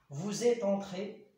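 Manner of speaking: slow, loud and high, entré stretched; teacher stressing a model sentence; emphasized example. Shown as a demonstration. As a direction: hold each word of "Il est entré" out for the class. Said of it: "E accent S إلا كان مذكر".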